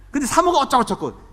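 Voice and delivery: 사모가 어쩌고저쩌고 tossed off high, sneering blah-blah; mock gossip. Pastor mocking gossip about his wife, high sneer. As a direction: mimic a nosy churchgoer's high sneer on 사모가.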